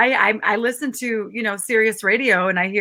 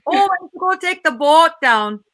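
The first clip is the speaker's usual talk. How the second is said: Minnesota accent; 5 semitones higher than usual. Shown as a demonstration. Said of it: "say boat".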